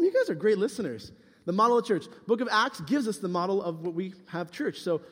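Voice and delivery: ordinary talk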